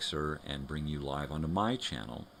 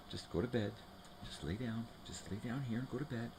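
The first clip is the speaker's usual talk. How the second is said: soft, high coaxing voice, softer; soothing the dog; 7 semitones higher, 6 dB quieter than usual. Real, not acted. Owner softly coaxing the dog to bed, soft high coax. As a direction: gently coax the dog to lie down, much softer.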